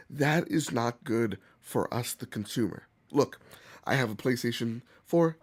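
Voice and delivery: ordinary talk, nothing marked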